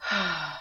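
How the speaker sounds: long deep exaggerated sigh; dramatic sigh. Shown as a demonstration. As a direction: heave a big theatrical sigh. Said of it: "Ready? Big sigh".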